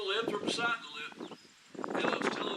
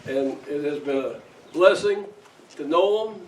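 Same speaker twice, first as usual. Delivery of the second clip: loud, earnest pulpit thanks; preaching at full force; 11 dB louder than usual. Real, not acted. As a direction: proclaim it with full heart. Pastor's heartfelt loud tribute.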